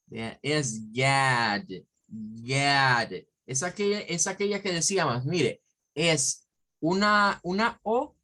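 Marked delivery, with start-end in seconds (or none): 0.9-3.1 low, long "Yad" with vowel between A and O; impure A. Shown as a demonstration. Teacher explains the word for God, "Yad" with an open A-like O. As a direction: draw out "Yad", mouth shaped for A while saying O. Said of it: "esta no es una A pura".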